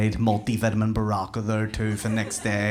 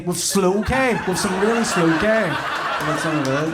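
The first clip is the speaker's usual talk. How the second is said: high and louder, laughing; cracking up while repeating it; 8 semitones higher, 4 dB louder than usual. Real, not acted. Comic mocking an audience member, laughing high. Crowd banter, mocking a request.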